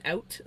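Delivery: "out" with the wide standard vowel; contrasting out vowel. Shown as a demonstration. Streamer showing what she doesn't say, wide-vowelled "out". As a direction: say "out" without the Canadian rounding. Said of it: "I say more oat or out".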